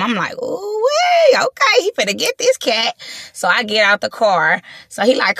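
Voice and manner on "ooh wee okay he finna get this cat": high squealing delight; excited squeal. Retold or imitated. Herself reacting to his compliment, a high thrilled squeal. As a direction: squeal like you've just been flattered.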